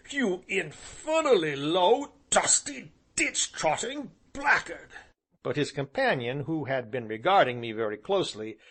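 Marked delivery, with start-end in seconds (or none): 0.1-4.9 a slow, high sputter of contempt; sputtering insult. Acted. Angry gentleman insulting the traveller, high and slow. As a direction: spit each insult slowly.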